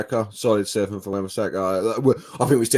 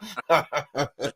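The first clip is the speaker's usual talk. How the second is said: laughing; 2 semitones higher, 4 dB quieter than usual. Real, not acted. Laughing at own impression.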